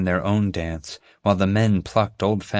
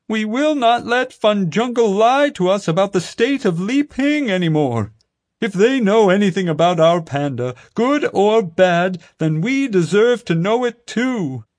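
high, louder, slowing proclamation; fervent protest speech; 13 semitones higher, 5 dB louder than usual. A narrator's reading. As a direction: rouse the crowd, hammer it home. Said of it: "Crazy Panda Guy was saying".

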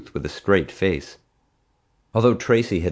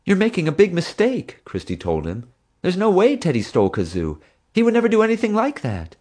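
a lightly voiced earnest young woman, pitched higher; higher, insistent young keeper; young zookeeper; 12 semitones higher than usual. A narrator's reading. Christy defending Teddy.

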